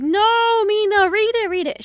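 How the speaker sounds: mock-frantic reader yelling at the book; exasperated reader's plea. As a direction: urge Mina loudly, easing off at the end. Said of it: "it's like".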